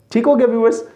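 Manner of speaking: ordinary talk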